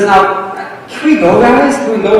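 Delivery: high, pleading can we go guys; exasperated plea. Retold or imitated. Missionary urges his guides onward, exasperated high plea. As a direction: beg them to get moving. Otto pleading to keep moving.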